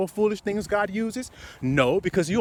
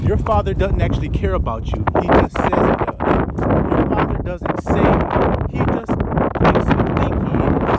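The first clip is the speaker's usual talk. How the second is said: fiery preaching; 3 semitones higher, 6 dB louder than usual. Acted. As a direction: lean in and preach it hard. Preaching at full force.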